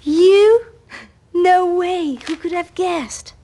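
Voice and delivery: high, loud disbelief; astonished. Acted. Friend learns the other was in analysis, astonished. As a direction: burst out in amazed surprise.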